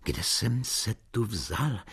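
plain reading voice

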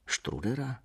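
soft, rising amazed question; hushed surprise; quiet astonishment. A narrator's reading. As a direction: ask it softly, taken aback. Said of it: "zeptal se tiše a udiveně".

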